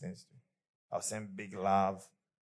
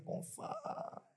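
very high, thin little voice; cartoonish high squeak; squeaky mind voice; 6 dB quieter than usual. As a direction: squeak it like a nagging inner voice. Voice of the suspicious mind.

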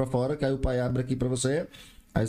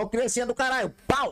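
mock scolding yell; 11 semitones higher than usual. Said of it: "De olhar e falar".

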